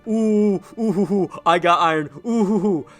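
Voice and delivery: high, loud mock ghost hooting; spooky ghost taunt. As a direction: hoot like a silly ghost.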